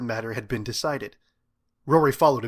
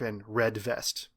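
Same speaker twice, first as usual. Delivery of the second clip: ordinary delivery, nothing marked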